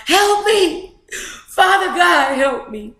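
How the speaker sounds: mock scream. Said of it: "screaming out".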